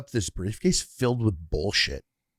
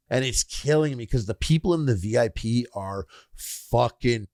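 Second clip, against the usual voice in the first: normal talking voice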